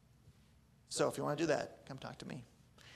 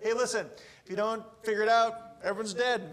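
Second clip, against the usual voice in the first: mock prophet warning; 6 semitones higher, 5 dB louder than usual. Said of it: "the prophet would come and be like".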